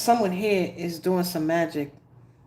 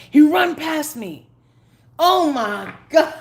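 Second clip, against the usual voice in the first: higher, louder and slow, emphatic; retold fright; 7 semitones higher, 6 dB louder than usual. Telling how the water bug ran past her, loud slow panic. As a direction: relive the panic, stretching it.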